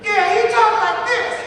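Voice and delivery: high, loud cartoon Globetrotter voice; Curly Joe. Actor demonstrates his Globetrotter character, high cartoon voice. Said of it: "I do Curly Joe".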